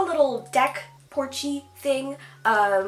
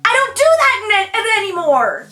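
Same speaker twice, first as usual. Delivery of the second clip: high and loud, tripping over anymore; triumphant, words tumbling out; excited declaration; 9 semitones higher, 9 dB louder than usual. Real, not acted. Telling viewers she's changed, excited and loud.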